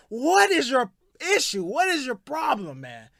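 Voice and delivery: rising fury, each question pushed harder; angry rant. Real, not acted. Radio host rants at Ben Simmons, angry shouting.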